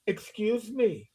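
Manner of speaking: slow, quiet, affronted retort; retold offended question; offended challenge. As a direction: draw it out as if offended. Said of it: "And then I get the".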